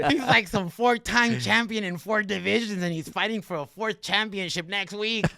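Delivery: high, loud retelling of an amazed coach; excited coach. Retold or imitated. Coach reveals opponent's titles to fighter, excited and loud. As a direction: crank it up, voicing the astonished coach.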